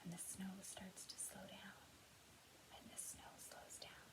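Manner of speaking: high hush fading to a whisper; hushed snowfall. Pretend. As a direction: let the snow die away softly. Snow slowing in sound game.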